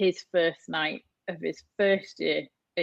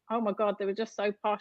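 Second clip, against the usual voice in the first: ordinary talk, nothing marked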